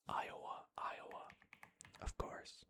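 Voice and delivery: ordinary talk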